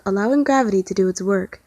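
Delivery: ordinary delivery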